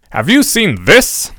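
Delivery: a hint of a grave teenager; lightly voiced Fred. A narrator's reading. Fred hands Flume the newspaper, slow and pointed.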